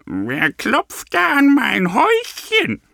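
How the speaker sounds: put-on high, gruff old granny voice; suspicious old granny squawking high at the door; barsch. A narrator's reading. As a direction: bark it like a suspicious old woman. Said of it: "Deshalb fragte sie barsch".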